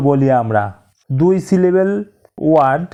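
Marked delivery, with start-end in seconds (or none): none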